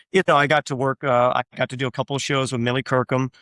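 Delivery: ordinary talk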